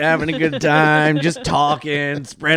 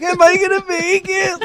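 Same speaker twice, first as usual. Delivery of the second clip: very high, worried question; nervous whine; 4 dB louder than usual. Retold or imitated. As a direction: squeak it out like a scared newcomer. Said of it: "I kept being like".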